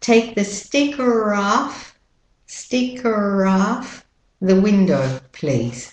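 slow American "sticker off", "off" like "ahf"; American accent. Shown as a demonstration. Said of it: "And with an American accent".

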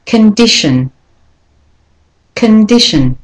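de-stress first and last syllables of "Condition"; careful model of "Condition" with two schwas. Shown as a demonstration. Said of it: "is said as a schwa".

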